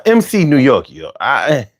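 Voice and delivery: usual speaking voice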